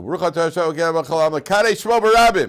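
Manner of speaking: high, loud, ringing prayer shout; yelled blessing. Retold or imitated. Man at gunpoint yells a blessing, loud and high. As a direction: cry the blessing out to the heavens. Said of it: "So the guy yells out".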